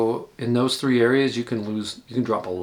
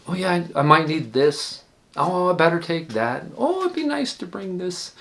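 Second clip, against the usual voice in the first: higher, eager overpacker voicing each item; a hint of a giddy packer grabbing one more thing; imitating his old self; 7 semitones higher, 3 dB louder than usual. Hiker mimics his past overpacking self, eager and higher.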